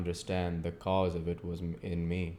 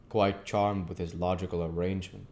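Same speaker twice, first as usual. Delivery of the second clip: ordinary delivery, nothing marked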